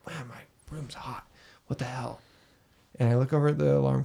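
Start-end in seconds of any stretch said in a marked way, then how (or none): 0.1-2.2 half-asleep, much softer mumble; groggy mutter. Retold or imitated. Telling a friend about waking at three, soft groggy mumble. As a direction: mumble it like you've just woken up. Groggy at 3am in hot room.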